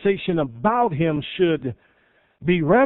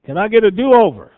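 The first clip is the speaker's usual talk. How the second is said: mock exasperation; 5 semitones higher, 9 dB louder than usual. Parent joking about teenager.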